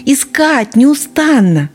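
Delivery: high, loud, eager fox cub; excited. Acted. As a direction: explain it in a rush of excitement. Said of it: "возбуждённо пояснял лисёнок".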